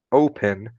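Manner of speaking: "open" with O emphasized; stress on first syllable. Shown as a demonstration. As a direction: lean on the start of "open". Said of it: "o início da palavra que for a parte mais forte".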